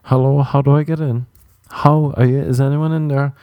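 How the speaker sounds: high, silly call through a door; mock calling out. Imagined knocker outside a public restroom, mock calling out.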